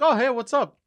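high, loud mock-casual hello; exaggerated high hello; acted greeting. Acts out greeting in his dream.

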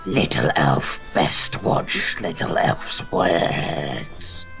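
low, slow dragon speaking in third person; half dragon voice. Acted. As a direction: rumble it slow like a beast. Dragon voice again.

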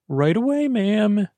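high, chirpy little yes-ma'am; a servant hopping to it; eager servant. Acted. Maid Holly answering the queen in a high, chirpy voice.

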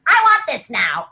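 loud, high whiny demand; selfish inner voice. Retold or imitated. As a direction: act the spoiled me who wants it now. Voicing own selfish self.

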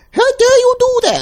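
very high indignant woman voice; shrieking woman. Retold or imitated. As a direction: shriek it at him like an outraged wife. Woman yelling in trailer scene.

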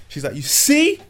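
high, loud squeal of reproach; hammed-up mum, voice shooting up; imitating upset mum. Mum scolding son over results, high-pitched reproach. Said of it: "when your mum can't speak, she's like".

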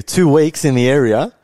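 a lightly put-on British slang voice; mock UK accent. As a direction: drawl it like a London lad. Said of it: "And I said to you".

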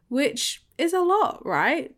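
high, emphatic rise on "lot". Real, not acted. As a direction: stress "lot" with a high lift. Emphatic aside about the year.

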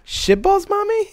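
put-on high-pitched little-kid query, rising; innocent questioning child. Little Harper asking mommy about the word, innocent questioning voice. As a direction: ask it like a puzzled small child. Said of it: "it was in question form".

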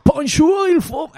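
hammed-up movie German accent, pitched high; mock the German accent of old war films; fake German film accent. Friend retelling a party, hamming up a film German accent. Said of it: "avec un putain d'accent, euh, de l'allemand qu'il y a dans les films".

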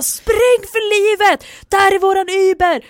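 high, loud drawn-out shout; dramatic mock-panicked call to run; urgent yell. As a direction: holler to friends as you flee. Acts out yelling while running away.

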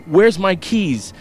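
high, loud, panicked search; frantic. Acted. Father frantically searching for keys, high panicked voice.

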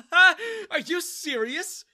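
high mocking laugh into words. A narrator's reading. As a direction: laugh in scorn. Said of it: "Daniel started laughing".

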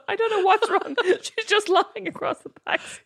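high-pitched quote dissolving into laughter; laughing mock bafflement. Taxi driver calling the cops, voiced through laughter. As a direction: play the baffled caller while giggling.

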